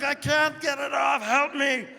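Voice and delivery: high-pitched mock cry for help; imitating a girlfriend stuck and pleading; panicked plea. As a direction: call out in hammed-up panic. Acts girlfriend stuck, begging for help.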